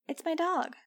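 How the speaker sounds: put-on a stubborn little girl; child's voice. A narrator's reading. Angel telling Max about her dog, high child's voice. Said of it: "Angel said".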